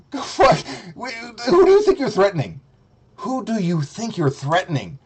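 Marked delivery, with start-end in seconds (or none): 0.1-2.6 higher, outraged challenge; mock indignant. Taunting the rival streamer, high outraged challenge. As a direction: throw the question back at him.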